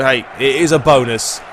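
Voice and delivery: normal talking voice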